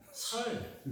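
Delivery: mimicking the father stubbornly saying 살; father's Gyeongsang 살. Son mimics father's 살 pronunciation. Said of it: "살 그러셨어요".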